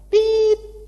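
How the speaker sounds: high loud beep imitating a censor bleep; mock censor beep. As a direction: bleep out the brand name. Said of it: "Loh kan direkam, ada mereknya nggak boleh ngomong".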